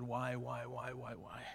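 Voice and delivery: whispered why, fading to breath; anguished whisper. Retold or imitated. Son retelling his grief to God, whispered. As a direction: plead with God under your breath.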